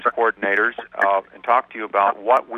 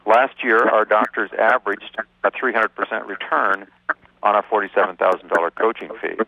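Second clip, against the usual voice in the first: ordinary talk, nothing marked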